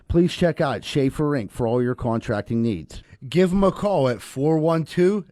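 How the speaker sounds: hammed-up ad announcer voice; mock radio ad read. Pitching fake sponsors to listeners as an ad read. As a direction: sell it like a local commercial. Reads comedic fake ads.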